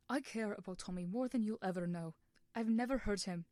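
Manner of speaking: heated insistence. Acted. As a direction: jab each word at him. Wilbur jabbing finger at Schlatt.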